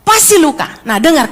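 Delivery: ordinary talk, nothing marked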